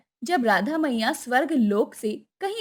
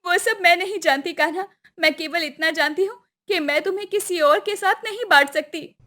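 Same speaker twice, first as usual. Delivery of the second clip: higher-pitched, pleading heroine; high, earnest lover's voice; Radha, possessive in love; 5 semitones higher than usual. Acted. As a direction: plead as a devoted young goddess.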